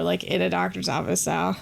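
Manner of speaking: ordinary talk, nothing marked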